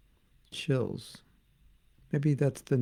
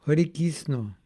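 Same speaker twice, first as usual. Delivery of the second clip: Bengali accent; 2 semitones higher, slower than usual. Shown as a demonstration. Devotee showing off his accent to listeners, Bengali accent. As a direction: chant greeting in a Bengali accent. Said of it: "likes my Bengali accent".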